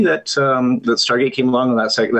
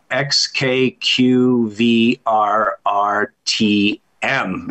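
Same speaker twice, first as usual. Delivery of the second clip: very slow, letter by letter; reading letters aloud; much slower than usual. Acted. Czech patient reading the optician's bottom line, slow and deliberate. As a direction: spell out the chart slowly and evenly. Joke: patient reads eye chart.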